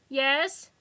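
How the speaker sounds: high, loud call from behind the glass; shouting over noise. A narrator's reading. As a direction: shout to be heard over the din. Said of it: "the voice shouted over the waiting room din".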